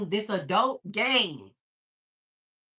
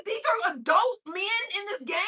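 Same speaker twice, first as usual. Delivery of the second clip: high, quick retold question; shocked disbelief; 10 semitones higher, faster than usual. Mother pressing son about the players, shocked disbelief. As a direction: rush it out in high-pitched disbelief.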